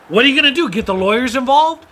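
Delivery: loud, high, scornful retort; mock defiant challenge. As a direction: throw it back at them, loud and high. Retold defiant parent in argument.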